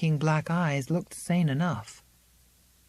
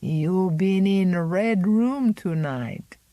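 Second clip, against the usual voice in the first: put-on slow, knowing old woman's voice; an old servant who knows the secret; 2 semitones higher, 4 dB louder, slower than usual. Old Zélie probing Miranda about the Red Room, knowing.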